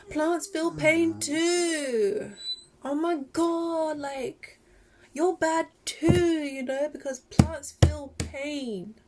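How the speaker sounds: mocking meat eater. Vegan mocks meat eaters' argument in a whiny voice. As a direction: parody the argument in a drawn-out whine. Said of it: "meat eaters will come to this argument of".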